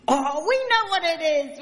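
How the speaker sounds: put-on high excited children's call; children shouting. Children shouting to Nanny that they know. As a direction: shout it out like eager kids. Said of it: "we shouted".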